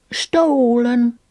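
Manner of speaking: talking through a mouthful; mouth full. Acted. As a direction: say it round a bite of porridge. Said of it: "replied Pigwig with her mouth full".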